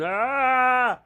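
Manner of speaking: high mock dog scream; hammed-up ghostly dog scream. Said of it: "dogs walking around the moors going".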